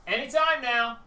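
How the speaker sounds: loud, high, impatient prod; impatient call. Acted. Game master loudly hurrying the player along, impatient.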